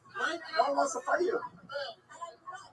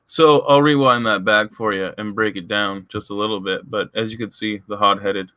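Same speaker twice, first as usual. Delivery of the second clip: very low, electronically lowered commentary voice; pitched-down voice effect; 10 dB louder than usual. Acted. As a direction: sound like a voice changer dropped you way down. Voice-changed commentary over replay.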